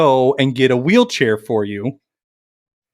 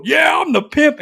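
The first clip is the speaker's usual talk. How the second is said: high, whooping brag; excited, high-pitched mock cheer; mock drunken boast; 10 semitones higher than usual. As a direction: imitate a drunk friend proudly hollering. Imitating drunk friend's delighted boast.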